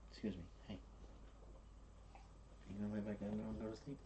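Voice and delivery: soft, hushed calming of a pet; barely above a breath, gentle; soothing dog. Real, not acted.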